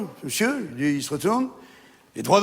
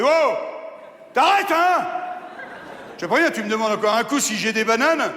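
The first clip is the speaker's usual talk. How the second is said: angry barman; 7 semitones higher than usual. Acted. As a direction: snap at the pest. Barman loses temper.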